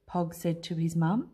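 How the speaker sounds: usual speaking voice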